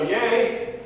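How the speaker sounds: a high, bright family cheer on "Yay!". Retold or imitated. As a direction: cheer it like the target was hit. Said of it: "we go".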